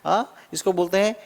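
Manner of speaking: ordinary delivery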